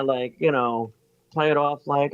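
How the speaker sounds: ordinary talk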